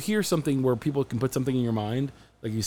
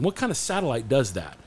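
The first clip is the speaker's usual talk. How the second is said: ordinary talk, nothing marked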